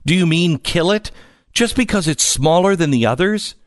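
put-on high, disbelieving child's questions; appalled little girl, mildly acted; mild shriek. A narrator's reading. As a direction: push the pitch up in protest. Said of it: "shrieked Fern".

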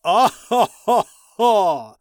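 loud, high booming villain cackle; evil villain laugh. Acted. Ice King turning to gloat at the brothers, loud high evil laugh. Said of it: "he spun around".

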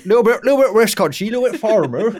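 light West Country farmer voice; a hint of West Country burr; West Country accent. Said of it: "Was that your West Country accent?".

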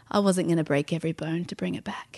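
soft, whispered grieving reply; hushed whisper. Retold or imitated. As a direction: confide it almost without voice. Grieving husband explains his choice.

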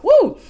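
sudden high, loud "woo"; excited whoop. Real, not acted. Preacher announcing a tour, excited whoop. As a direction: let out a soaring whoop.

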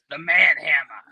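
Manhammer in a hammed-up Boston accent. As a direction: announce it like a Boston tough guy. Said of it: "with the Boston voice".

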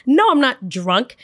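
high-pitched, exasperated sarcastic comeback; indignant retort. Retold or imitated. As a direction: act out an offended, sarcastic denial. Acted retort to mom's question.

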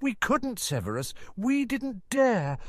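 high, slow, near tears; holding back a sob. Acted. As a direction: fight the sob. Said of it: "She let out a sob".

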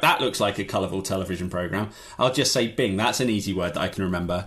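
low, fast, vague dad mumble; absent parent. Retold or imitated. Guest mocking a distracted father, low and fast. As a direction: breeze through half paying attention. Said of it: "drift through a room and be like".

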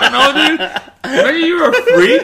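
talking through loud laughter; can't stop laughing while talking. Real, not acted. Breaks up after the roleplay.

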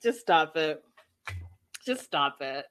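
dry, dismissive stop it; exasperated. Real, not acted. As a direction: tell them to quit, trailing into a low mutter. Exasperated at the show.

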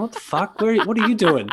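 high, disbelieving cry with a laugh; shocked. Retold or imitated. Coworker retelling seeing his boss wet on a call, shocked. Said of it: "I'm going".

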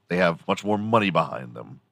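usual speaking voice

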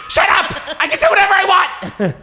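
high, loud shrieking rant; scary influencer impression. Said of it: "my impression of that is, like, so scary".

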